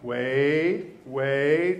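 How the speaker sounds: rising, drawn-out warnings to a dog; coaxing wait. Retold or imitated. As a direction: hold the dog back, each wait lifting. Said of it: "You say".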